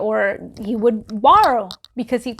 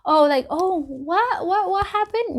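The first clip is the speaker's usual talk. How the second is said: mock innocence; 8 semitones higher than usual. Mocking feigned innocence.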